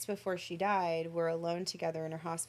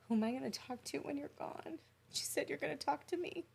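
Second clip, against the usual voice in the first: fighting back tears while telling it; trembling, tearful retelling; 10 semitones higher, 5 dB quieter than usual. Real, not acted. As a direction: voice high and tight, close to tears. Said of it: "We need a tissue box".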